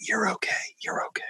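imitating a soft, unvoiced whisper; sweet reassuring whisper. Coworker soothing Bart before he sees the boss, whispered. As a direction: breathe it gently to calm him. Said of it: "in her sweet, sweet Southern spirit".

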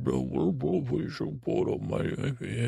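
slow, garbled mock impersonation; terrible Wilford Brimley. Shown as a demonstration. Narrator recalling his childhood tape, terrible slurred imitation. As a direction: drag the words out in a badly faked impression. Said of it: "a terrible Wilford Brimley imitation".

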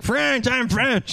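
high, drawn-out mock French; fake French accent. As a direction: insist in a silly, stretched French accent. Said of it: "when [name] tries to be French".

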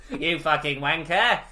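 hammed-up Cockney sneer; Cockney villain. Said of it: "that British guy's voice".

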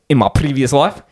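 put-on South African accent, breaking into laughter. Demonstrating to friends how South Africans talk, South African accent. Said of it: "South African would be like".

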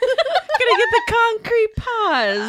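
slow, high, loud mobster threat; drawn-out mock mobster voice; old-timey gangster. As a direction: ham up a cartoon gangster, laughing. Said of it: "one of those old timey".

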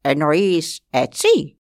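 slow, chest-puffed boast; proud. Acted. The proud eagle asks Glooscap if he means like this, slow. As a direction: show off, very slowly. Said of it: "φουσκώνοντας το στήθος του όλο περηφάνια".

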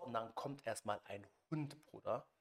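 usual speaking voice

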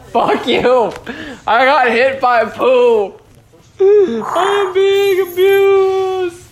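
high, drawn-out wail of a victim; mock distress. Podcaster hit by a toy bear, mock distressed wail. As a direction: cry out in hammed-up outrage. Play fight, cries out as victim.

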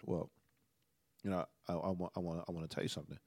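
low, hushed, hesitant lead-in; imitating her quiet, careful voice; soft spoken. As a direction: ease gently into hard news, very softly. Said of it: "She, like, real soft spoken".

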